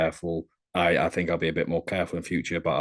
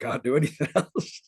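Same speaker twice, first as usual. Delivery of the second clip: higher, laughing mock Austrian accent; Arnold Schwarzenegger; 6 semitones higher than usual. [name] pleading it's his only accent, imitated Schwarzenegger accent. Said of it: "you sound like Arnie".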